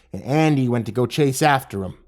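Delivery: usual speaking voice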